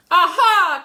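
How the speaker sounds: very loud, high aha; triumphant cry. Acted. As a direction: catch him in the act with a shout. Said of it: "cried Martha".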